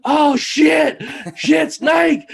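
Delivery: high, loud yell imitating a startled man; panicked shout. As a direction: act out a scream of alarm from the shower. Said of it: "all you hear in perfectly good English".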